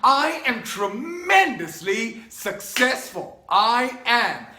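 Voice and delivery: slow, loud, insistent declaration; retold affirmation. Young man in his Beetle, slow, loud and insistent. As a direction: proclaim it to yourself over and over. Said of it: "I am saying to myself".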